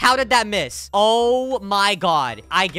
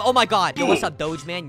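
normal talking voice

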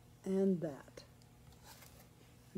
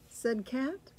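ordinary delivery, nothing marked

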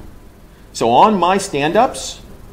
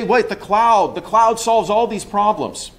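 lightly voiced eager skeptic, higher; mock objector; 7 semitones higher, slower than usual. Speaker mimics the audience member's cloud objection, lightly voiced higher. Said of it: "Oh, you may say".

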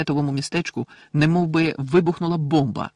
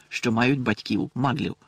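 ordinary delivery

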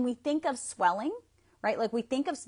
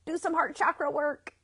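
lightly voiced heart calling out, higher pitch; a hint of a talking-heart voice, pitched up; 7 semitones higher than usual. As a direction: raise the pitch, as a heart giving a nudge. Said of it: "Their heart's like".

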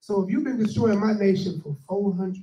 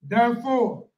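scripture reading; 4 semitones higher, 6 dB louder, slower than usual. Acted. Reads scripture aloud.

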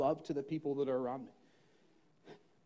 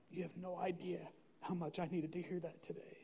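soft tearful quote; 12 dB quieter than usual. Retold or imitated.

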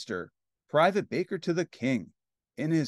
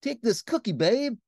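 higher, suave prince's offer; murmuring prince; 8 semitones higher, 3 dB louder than usual. Acted. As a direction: lightly play a flirting prince. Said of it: "he murmured".

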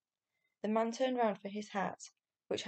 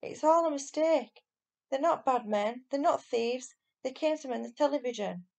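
high, loud, pleading calls; urgent shout; 5 semitones higher, 6 dB louder than usual. A narrator's reading. Boy shouts after Stig that the men are innocent, high and loud. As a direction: shout the truth before it's too late. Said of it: "shouted Barney".

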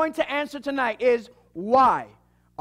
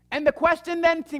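ordinary delivery

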